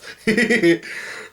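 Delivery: high-pitched giggling laugh; amused giggle. Real, not acted. Amused by the retold meal.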